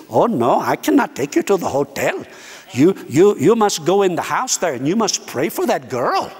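imitated Indian accent, emphatic insistence; playful Indian-English imitation. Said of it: "I'm practicing, and next time I go to India".

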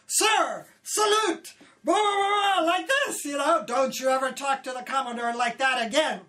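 loud, high angry scolding; yelled and screamed. Retold or imitated. A senior man furiously scolding a young crew member. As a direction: tear into the new girl. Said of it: "he yelled and screamed at me".

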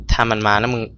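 usual speaking voice

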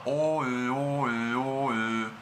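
slow O then repeated Ö; O-Ö drill. Shown as a demonstration. Teacher drilling the tongue position for Ö. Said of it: "La posición de la lengua".

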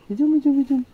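high, loud sing-song coaxing; talking to a dog. Real, not acted. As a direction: cheerfully call the dog along. (высоким ласковым голосом зовёт собаку)

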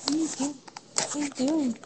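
normal talking voice

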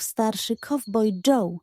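usual speaking voice

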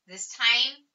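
usual speaking voice

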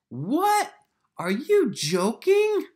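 high, loud astonished gasp of a question; chorus in disbelief. Pretend. Teacher voicing the chorus reacting to the spittlebug, high and loud. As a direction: sound shocked and amazed. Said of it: "change your voice for the characters".

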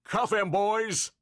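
high, drawn-out police command; shouted order. A narrator's reading. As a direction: order your officers sharply. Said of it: "shouted the police chief".

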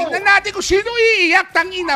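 high, loud, drawn-out dare; loud challenge. Real, not acted. Player daring rival over who will cry, loud challenge. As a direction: throw the threat back loudly.

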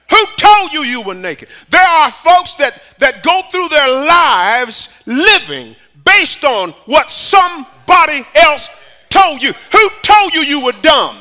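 high, loud, shouting sermon climax; preaching at full force. Acted. Preacher urging the church to run to God, shouting. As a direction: drive it home to the congregation.

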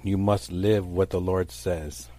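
usual speaking voice

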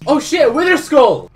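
high, very loud yell of discovery; thrilled, loud outburst; excited shout. Real, not acted. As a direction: shout out a lucky find. Spots rare item in game.

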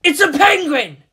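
loud, high, hammering "penguin"; exasperated shout at the teen; driving the point in. Acted. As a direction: hit "penguin" hard to make him get it. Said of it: "He had to drive that point in".

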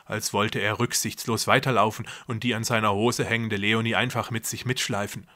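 ordinary delivery

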